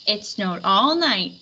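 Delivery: usual speaking voice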